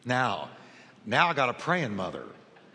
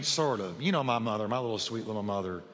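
usual speaking voice

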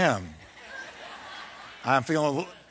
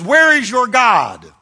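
slow, louder jeering challenge; mocking taunt; 5 semitones higher, 7 dB louder, slower than usual. Giant taunting the believer, slow loud jeer. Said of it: "He really basically says".

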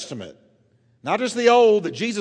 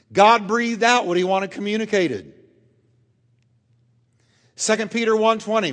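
normal talking voice

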